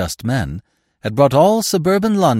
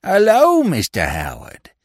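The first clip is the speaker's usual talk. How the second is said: loud, high greeting with put-on delight; feigned joy; 10 semitones higher, 5 dB louder than usual. A narrator's reading. Pickpocket greets the officer he dreads, feigned joy. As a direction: exclaim it as if thrilled, falsely. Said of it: "he exclaimed with feigned joy".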